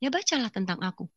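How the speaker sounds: soft, calm answer; the mysterious voice. Retold or imitated. The voice answers her question, soft and calm. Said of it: "suara itu coba bilang".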